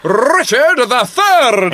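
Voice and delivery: slow grand title read; dramatic announcer. Acted. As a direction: announce it like a movie trailer.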